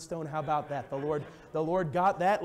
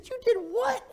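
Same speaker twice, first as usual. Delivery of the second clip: very high, astonished and half laughing; a parent shocked at his son; squealing incredulous outburst; shocked disbelief; 3 dB louder than usual. Retold or imitated. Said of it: "I said".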